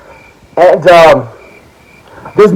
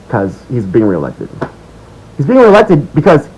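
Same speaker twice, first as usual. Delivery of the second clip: ordinary talk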